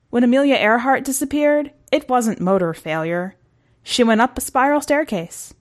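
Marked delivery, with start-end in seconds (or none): none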